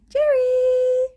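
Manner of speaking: high, loud, drawn-out call; calling a pet. Real, not acted. Calling the pet once more.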